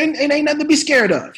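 loud, high, impatient pep talk; Sam Jackson. Retold or imitated. Star urging a nervous rookie through the scene, impatient. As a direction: imitate a star pushing a rookie onward. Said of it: "He said".

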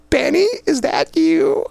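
high, drawn-out teasing squeal of the name; mocking imitation. Cop teasing Benny over the radio, high mock squeal. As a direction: ham up her line to rib him. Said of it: "one of the other cops would key the microphone".

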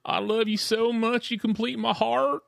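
high, drawn-out blubbering imitation; hammed-up lovesick wail on the phone; mock crying. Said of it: "crying to her".

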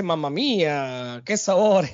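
put-on playful Italian accent, stretch the mamma mía; Mario Italian accent. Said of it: "Tiene su acento italiano".

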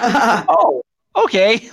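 surprised okay. Retold or imitated. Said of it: "Like that".

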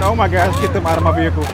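ordinary delivery

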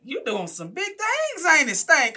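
high, slow, loud mock mayor; mayor calling to praise her, slow and high; mayor's voice. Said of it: "was like".

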